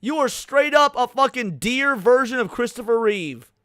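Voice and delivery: normal talking voice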